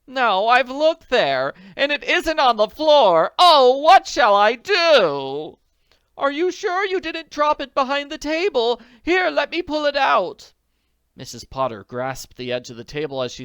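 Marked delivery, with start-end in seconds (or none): 0.1-10.5 very high, loud frantic. Acted. Friends search frantically, high panic. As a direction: search in desperation.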